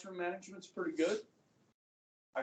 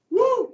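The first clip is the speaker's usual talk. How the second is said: loud high cheering whoop; excited whoop; 13 semitones higher, 13 dB louder than usual. Acted. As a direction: whoop it up like a rally crowd. Cheer before repeating line.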